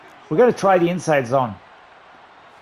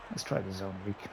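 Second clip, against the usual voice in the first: barely audible play call, much softer; muttered to himself; 9 semitones lower, 18 dB quieter than usual. Real, not acted. Gamer muttering his next play to himself, quietly. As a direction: mutter the call under your breath.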